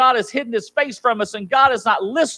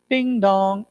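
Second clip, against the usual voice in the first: doorbell imitation. Mock delivery doorbell.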